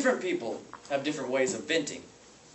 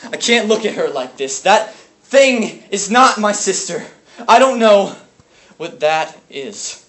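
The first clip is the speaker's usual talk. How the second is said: loud, raised grief; anguished outburst; 6 semitones higher, 10 dB louder than usual. Acted. Brother unable to look at his sister's body, anguished outburst. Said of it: "grieving over an unbearable past".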